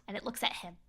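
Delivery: whispered suspense. A narrator's reading. Narrator building tension as creature stares, whispered. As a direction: hush it like a secret.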